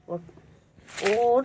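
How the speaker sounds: normal talking voice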